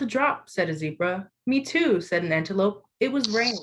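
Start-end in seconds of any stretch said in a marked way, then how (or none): none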